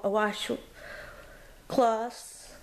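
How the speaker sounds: ordinary talk, nothing marked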